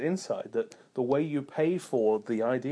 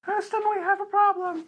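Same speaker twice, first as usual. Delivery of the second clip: very high, loud squeaky voice; squeaky helium voice; 5 dB louder than usual. Pretend. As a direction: call Houston on helium. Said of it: "really high-pitched squeaky voices".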